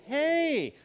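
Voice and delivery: hey sliding up high; bright upward hey; rising hey. Shown as a demonstration. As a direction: greet a long-lost friend. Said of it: "that tonality that goes up".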